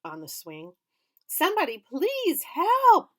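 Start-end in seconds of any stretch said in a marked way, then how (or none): 1.2-3.1 much higher, much louder, slow; panicked scream. A narrator's reading. Girl wobbling on swing screaming. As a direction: shriek in panic. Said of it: "Sheila's screams".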